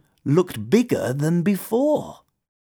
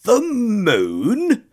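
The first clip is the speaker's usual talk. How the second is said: incredulous scoff, pitched up; higher, mocking disbelief; scoffing toad; 8 semitones higher than usual. A narrator's reading. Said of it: "scoffed the Toad".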